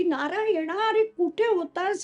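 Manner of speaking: mildly acted outburst of a relieved, cross mother; mother's scolding yell. A narrator's reading. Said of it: "ती इतक्या जोरांनी ओरडली".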